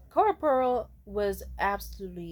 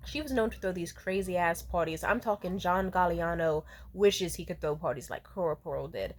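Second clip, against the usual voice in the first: ordinary talk, nothing marked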